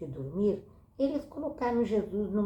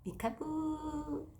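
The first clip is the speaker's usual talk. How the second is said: playful peekaboo; 9 semitones higher, 5 dB quieter, much slower than usual. Pretend.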